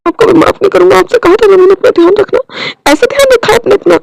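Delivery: husband shouting at his injured partner. Acted. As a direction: shout it out in reproach. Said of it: "अच्छा, चिल्लाना बंद करो".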